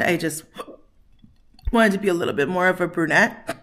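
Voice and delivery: ordinary talk, nothing marked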